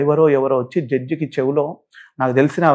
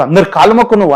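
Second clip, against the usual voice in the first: higher, louder barked command; retold harsh order; 4 semitones higher, 5 dB louder than usual. Judge ordering him to touch everyone's feet, harsh retold command.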